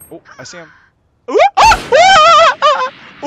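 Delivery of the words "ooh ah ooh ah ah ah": high-pitched "ooh, ah" hoots; high loud oohs and ahs. Real, not acted. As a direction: hoot excitedly, high and loud. Noises during play.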